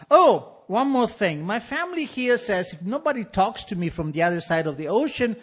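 a lightly voiced father, fake-sincere; hint of a wheedling father apologizing; father's letter voice. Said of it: "he continues".

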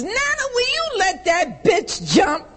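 high mocking shout out the window; husband shouting. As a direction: yell it at the neighbor, scornful. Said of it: "and he says".

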